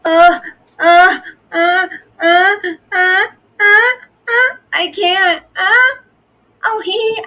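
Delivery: loud, high, hammed-up gasping sobs; mocking a friend with fake hysterical gasps, loud and high; mock sobbing gasps. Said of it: "That was a pretty good impression".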